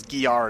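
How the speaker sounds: Gyarados said as ghee-AR-uh-dose; childhood misreading. Shown as a demonstration. Admitting a kid's reading, Gyarados as ghee-AR-uh-dose. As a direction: begin Gyarados with "ghee", then hit AR. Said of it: "I always read it as".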